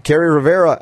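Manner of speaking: ordinary delivery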